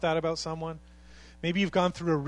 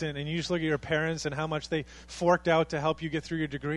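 normal talking voice

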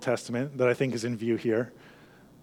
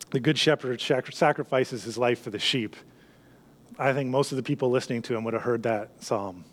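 usual speaking voice

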